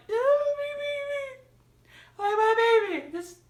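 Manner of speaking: high, crying wail over a beloved character; hammed-up sob for my baby; mock wailing. Fan retelling her grief over a character, mock crying. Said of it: "But I was just like".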